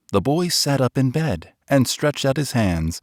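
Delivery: ordinary delivery, nothing marked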